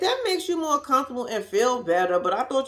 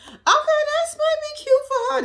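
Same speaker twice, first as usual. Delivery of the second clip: high mock sweetness; 4 dB louder than usual. Real, not acted. Mocking the engagement ring plan.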